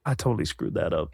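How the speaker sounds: low, soft retold defeat; dejected self-talk. Actor back in the waiting chair, low mock dejection. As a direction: mumble it low to yourself, deflated.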